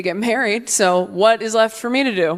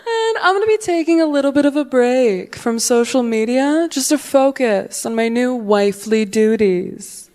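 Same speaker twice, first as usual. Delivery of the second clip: higher, gushing video voice; bubbly influencer; 4 semitones higher than usual. Retold or imitated. Newlywed girl in her Instagram video, higher bubbly gush. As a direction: gush to the camera. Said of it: "She's like".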